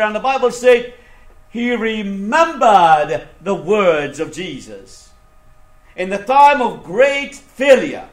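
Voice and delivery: high, loud sermon cadence with stretched key words; fervent preaching. Acted. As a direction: proclaim it to the congregation in swelling phrases. Preaches the Spirit's coming.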